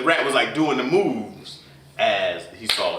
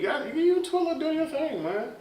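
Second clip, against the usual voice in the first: teasing; 3 dB quieter than usual. Acted. Teasing envy.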